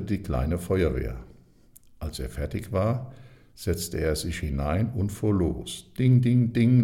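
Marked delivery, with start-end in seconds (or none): 6.0-6.8 higher, louder bell "ding"; playful spoken bell; bell ringing. A narrator's reading. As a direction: ring out like a toy bell. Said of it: "läutete die Glocke".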